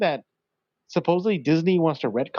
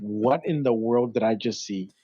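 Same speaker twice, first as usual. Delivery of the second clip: stunned disbelief; 6 semitones lower, slower than usual. Retold or imitated. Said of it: "I remember walking out of the theater thinking".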